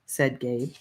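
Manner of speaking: plain reading voice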